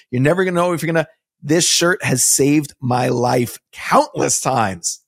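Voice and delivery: upbeat, emphatic sponsor plug; ad read. Acted. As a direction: pitch the shirts with punchy enthusiasm.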